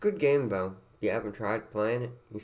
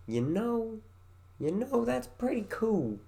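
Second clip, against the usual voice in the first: high voice; 12 semitones higher than usual. Pretend. Playful repeated high variations.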